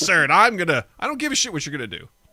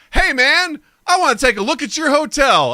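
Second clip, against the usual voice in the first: louder, higher swaggering voice; pimp's request; 10 semitones higher, 7 dB louder than usual. Retold or imitated. Pimp at the hotel desk asking the clerk for a look, loud and high. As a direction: play the pimp, loud and pitched up. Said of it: "a pimp walk into the hotel lobby and say".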